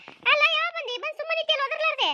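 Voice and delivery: put-on high, loud scolding old woman's voice; high-pitched village auntie, loudly chiding; scolding neighbour woman. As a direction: call her out for standing there silent.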